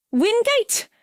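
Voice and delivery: Sarah calls out the medium; crying out. A narrator's reading. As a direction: shout her name in alarm. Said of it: "cried Sarah".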